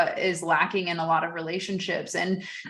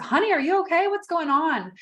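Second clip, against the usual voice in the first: tender concern; 9 semitones higher than usual. Retold or imitated. Speaker to a crying young vendor, tender and concerned. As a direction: gently check on someone in tears.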